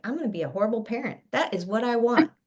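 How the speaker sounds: a hint of mock parent, rattled off fast; mock bad parent. Doctor to students, lightly voicing a parent in mock.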